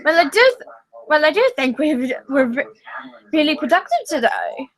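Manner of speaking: hammed-up British accent; fake British accent. Gilbert mocking Ludwig in a fake British accent. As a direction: put on a posh British accent for a laugh. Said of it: "He said in a fake British accent".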